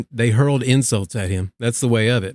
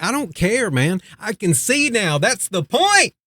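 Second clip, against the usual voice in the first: higher pitched, breezy I-don't-care brush-off; cocky dismissal; 8 semitones higher than usual. Retold or imitated. Healed man shrugging off the Pharisees, high and cocky. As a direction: toss it off like nothing could bother you. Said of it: "this dude's sitting here like".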